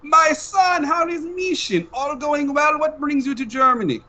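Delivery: a doting father checking on his son; Papa on the phone. Acted. Papa calls his son mid-mission, high accented voice.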